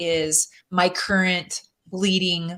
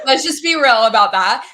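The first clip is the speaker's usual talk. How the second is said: louder, through real laughter; laughing; 4 semitones higher, 10 dB louder than usual. Real, not acted. Guest laughing about wearing pajamas in public, laughing. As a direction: laugh while you admit it.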